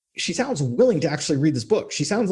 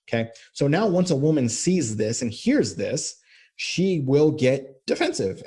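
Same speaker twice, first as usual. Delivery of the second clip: ordinary talk, nothing marked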